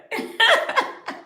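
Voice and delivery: bright burst of laughter; a high real laugh. Real, not acted. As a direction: laugh at dad's answer. Laughing at dad's reply.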